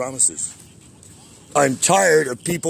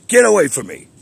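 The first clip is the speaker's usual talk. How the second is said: retold shout; 7 semitones higher, 7 dB louder than usual. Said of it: "I said".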